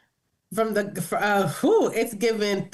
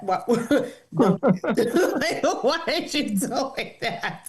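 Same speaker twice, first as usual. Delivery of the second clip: laughing, slowing as she asks; laughing disbelief; much slower than usual. Real, not acted. Laughing at a man texting yas with extra letters. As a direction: laugh through the question.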